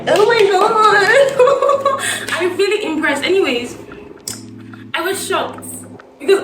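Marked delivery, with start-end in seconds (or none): 0.1-2.0 high, slow, laughing gasp of disbelief; drawn out in amazed laughter; stunned oh my God. Retold or imitated. Caught out at the office, laughing in shock. Shocked coworkers reveal she knew French.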